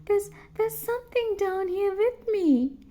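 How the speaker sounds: high, halting, frightened; scared little girl stammering; shaky voice. A narrator's reading. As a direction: stammer it out in fear. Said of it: "in a shaky voice".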